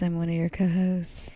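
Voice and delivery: soft breathy whisper; ASMR whisper. Acted. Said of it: "This episode's gonna be A S M R".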